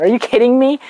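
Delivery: ordinary talk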